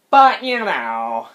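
imitating an irritating talker; annoying voice. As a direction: drag the words out mockingly. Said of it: "That's so annoying".

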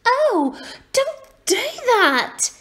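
put-on very high, drawn-out child's wail; protesting little girl. A narrator's reading. Little girl covers her ears at the screech, protesting. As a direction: complain in a high, slow girlish voice.

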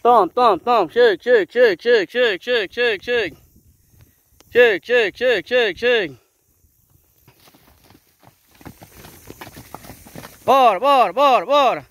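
high, loud call to the herd; calling horses. Real, not acted. Farmer calling his mares in from the pasture, loud high call. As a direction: sing out to bring the mares in.

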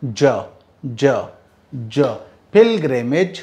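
ज repeated low, then pilgrimage; sound drill. Shown as a demonstration. Teacher drills the ज sound for students. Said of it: "इसको कहते हैं ज".

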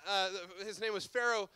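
normal talking voice

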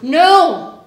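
sharp exasperated no; angry shout. Acted. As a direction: bark it out in frustration. Frustrated teacher snaps at student.

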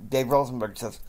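ordinary delivery

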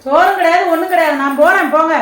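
loud, exasperated brush-off; annoyed refusal. Acted. Annoyed neighbour refuses to bring food, scolding loudly.

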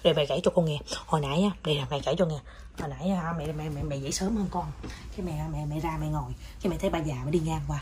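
low, very soft confiding tale; hushed storytelling. Pretend. Mother telling child about the old bogey woman, hushed and low. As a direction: tell it in a secretive undertone.

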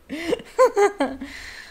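high burst of laughter; laughing. Real, not acted. Laughs at own Ron line.

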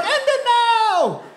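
high, slow hammed-up cry of despair; mock dramatic cry. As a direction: camp it up like a tragic heroine. Date's dramatic line acted out.

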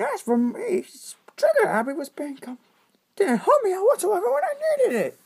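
high whiny cartoon complaint; squeaky high voice. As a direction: pitch way up and whine.

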